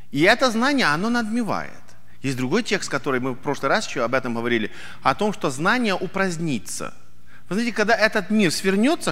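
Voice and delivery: ordinary talk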